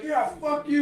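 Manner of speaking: loud, high told-you-so jab; answering the friends who laughed, loud and defiant; defiant retort. Retold or imitated. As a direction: throw it back at them, vindicated.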